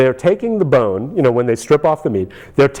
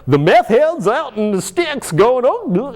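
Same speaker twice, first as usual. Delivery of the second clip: mock Southern; 8 semitones higher, slower than usual. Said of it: "that Hollywood does the South thing".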